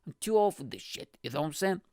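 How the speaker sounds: usual speaking voice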